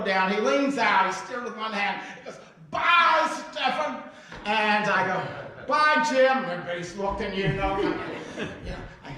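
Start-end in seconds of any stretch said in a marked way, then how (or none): 2.7-4.0 loud, high farewell shout from a car; calling out goodbye. Retold or imitated. Waving goodbye from car window. 5.7-6.4 loud, high goodbye called back; calling out goodbye. Retold or imitated.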